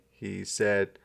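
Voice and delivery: usual speaking voice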